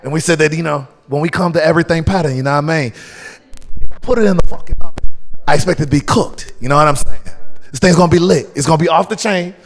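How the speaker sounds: mock British accent, loud and pumped up; Bo Gilligan. Guest shows host how gassed they are, British accent. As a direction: ham up a London comic's British accent. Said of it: "in your best British accent".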